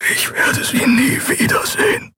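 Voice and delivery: grieving, voiceless whisper; faint, despairing hush; barely audible whisper. A narrator's reading. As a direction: whisper it as if to yourself. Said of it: "Und so leise, dass Endriel nur glaubte".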